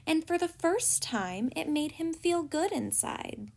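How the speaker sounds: plain reading voice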